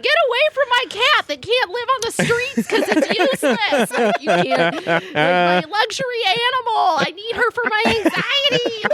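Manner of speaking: high-pitched, hammed-up panicked yelling; comically desperate, shrieky protest; frantic yelling. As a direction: play a frantic cat owner shooing predators. Acting out fighting hawks off cat.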